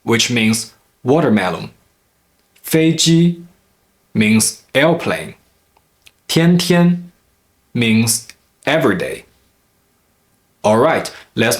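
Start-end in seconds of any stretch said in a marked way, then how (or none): 2.6-3.4 飞机 with both syllables high and level; two first tones. Shown as a demonstration. Teacher giving an example word, 飞机 in high, level tones. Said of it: "two consecutive first tone syllables". 6.3-7.1 天天 with both syllables high and level; two first tones. Shown as a demonstration. Teacher giving an example word, 天天 in high, level tones. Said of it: "two consecutive first tone syllables".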